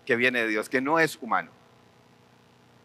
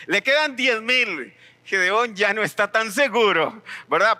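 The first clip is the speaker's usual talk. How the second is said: usual speaking voice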